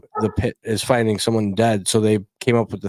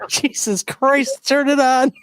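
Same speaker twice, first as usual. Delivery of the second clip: laughing exasperation; 13 semitones higher, slower than usual. Real, not acted.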